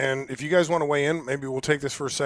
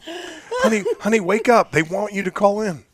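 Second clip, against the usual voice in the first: high, raised mock wake-up call; waking a passed-out spouse; 5 semitones higher, 5 dB louder than usual. Co-host to an imagined sleeping drinker, mock rousing. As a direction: pretend to rouse someone passed out.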